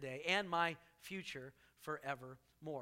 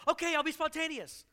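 mock eager reply; 9 semitones higher, 4 dB louder than usual.

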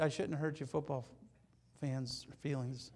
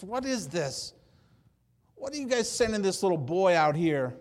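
mocking giant; 4 semitones higher, 8 dB louder than usual. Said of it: "He just".

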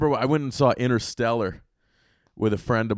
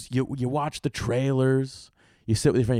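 usual speaking voice

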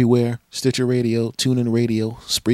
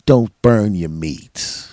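slow, drawn-out advice; slow mock wisdom; 6 dB louder, much slower than usual. Advising listeners, slow mock-wise. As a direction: draw it out like a sage.

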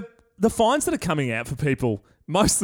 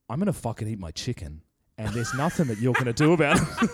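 defiant bloke, lower; 5 semitones lower, faster than usual. Retold or imitated. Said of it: "he goes".

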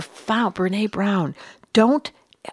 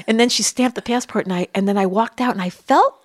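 ordinary talk, nothing marked

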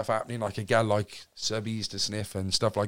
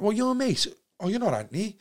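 surprised recognition; 9 semitones higher than usual. Retold or imitated. Voicing people recognising his surname.

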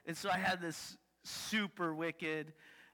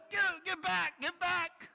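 protective warning; 10 semitones higher, 3 dB louder than usual. Retold or imitated. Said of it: "you're like".

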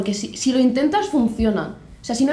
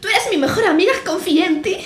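mock telenovela; 7 semitones higher than usual. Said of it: "el acento lo hacen como más telenovela".

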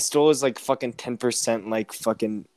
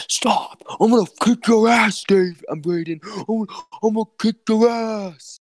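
mock angry yell; 10 semitones higher than usual. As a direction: ham up a friend losing it. Retelling Joel losing his temper.

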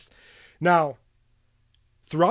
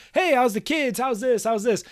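mock chatty small talk; 7 semitones higher than usual. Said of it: "If you're just sitting there".

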